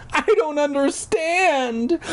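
high, drawn-out mock cry. Mother bewildered by her trapped child, crying out. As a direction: wail it slowly, way up in pitch. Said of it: "Alicia's mother cried".

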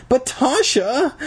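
high, drawn-out whiny plea; pleading. A narrator's reading. Boy begging Tasha to listen, pleading. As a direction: stretch the name out, high and imploring. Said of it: "I pleaded".

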